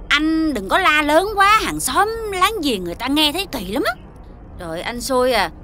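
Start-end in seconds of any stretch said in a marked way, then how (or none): none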